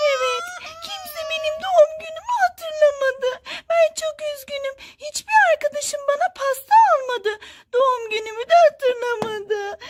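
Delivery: high mock-tearful doll voice; Cry Baby. Forgotten birthday doll complaining to the adult, high tearful whine. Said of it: "sen bunun için mi ağlıyorsun burada?".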